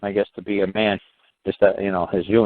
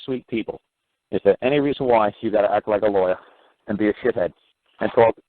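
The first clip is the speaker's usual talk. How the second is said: usual speaking voice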